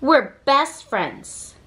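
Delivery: usual speaking voice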